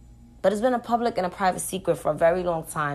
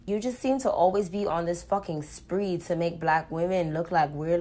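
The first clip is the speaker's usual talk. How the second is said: normal talking voice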